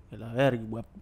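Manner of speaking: low, dropping hostile tone; menacing insult; other tone. Retold or imitated. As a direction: mutter it as a real insult. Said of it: "Ya es otro tono".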